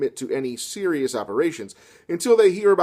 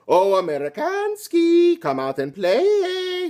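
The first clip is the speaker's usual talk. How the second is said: put-on slow, much higher taunting Russian accent; 4 dB louder, much slower than usual. Fascist soldier taunting Robert in a hammed-up Russian accent. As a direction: drag out the mock Russian taunt. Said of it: "thought he was supposed to be a Russian".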